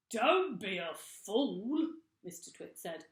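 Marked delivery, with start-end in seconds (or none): 0.1-1.9 loud, slow scornful bark; scornful husband. A narrator's reading. Mr Twit scoffing at his wife, loud and slow.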